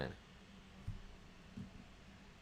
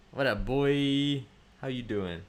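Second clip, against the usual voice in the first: drawn-out greeting; 4 semitones higher, 6 dB louder, slower than usual. Real, not acted. Greeting viewer Dan, drawn out.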